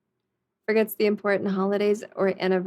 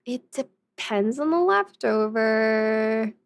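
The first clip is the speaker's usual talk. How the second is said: ordinary talk, nothing marked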